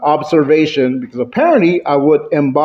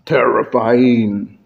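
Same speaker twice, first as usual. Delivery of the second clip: low, slow, ominous delivery; mock-scary, stretched word; terrifying; 5 semitones lower, slower than usual. Shown as a demonstration. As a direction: draw it out low to frighten. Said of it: "range of emotions".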